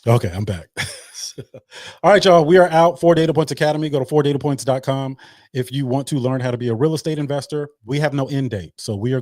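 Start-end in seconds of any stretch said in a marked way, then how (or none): 2.0-5.2 excited announcement. Real, not acted. Hype announcement to the room.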